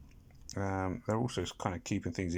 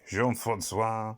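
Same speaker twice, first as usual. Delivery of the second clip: slow, drawn-out fake French; hammy French exclaiming, stretched out; silly French accent; slower than usual. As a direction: put on a badly faked French accent. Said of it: "doing the silly French accent".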